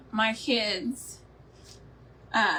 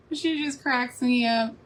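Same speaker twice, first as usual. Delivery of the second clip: ordinary talk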